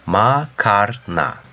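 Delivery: "Makarna" slow, in three parts: ma, kar, na; syllable by syllable. Shown as a demonstration. Teacher demonstrates the word for learners, "Makarna" slowly syllable by syllable. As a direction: stretch and separate each syllable of "Makarna".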